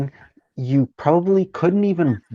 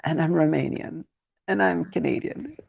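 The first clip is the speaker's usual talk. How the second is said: daughter's voice; 5 semitones higher, 3 dB quieter than usual. Retold or imitated. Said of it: "she's like".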